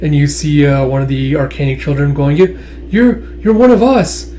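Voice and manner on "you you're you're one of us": put-on high, stammering child's plea; acted distressed child. Arcanic child pleading with one of their own, distressed. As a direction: pitch it right up and stumble over the words.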